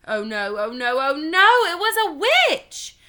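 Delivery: alarm building to a high, loud cry; mounting panic. Acted. Child spinning round to find a witch behind, panicking. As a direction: escalate each oh no into dread.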